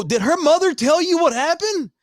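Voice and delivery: high, loud urgent question; hammed-up worried interrogation; mock alarm. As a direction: demand it in acted panic. Acts anxiously questioning dad.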